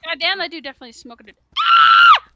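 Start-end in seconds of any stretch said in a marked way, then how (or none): 1.6-2.2 very high, loud shriek; startled scream. Real, not acted. Player almost seen by the house owner, startled scream. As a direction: let out a sudden piercing scream. Startled, nearly caught in game.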